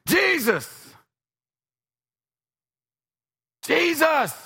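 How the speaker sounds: high loud call of the name. Acted. Blind man calling Jesus through the crowd, high shout.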